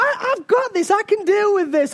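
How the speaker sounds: high-pitched, mock-confident reassurance to himself; retold self-assurance. Dad telling himself he can cope, high mock confidence.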